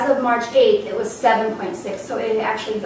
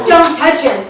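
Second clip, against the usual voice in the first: warning; 4 semitones higher, 9 dB louder than usual. Retold or imitated. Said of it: "Bill goes".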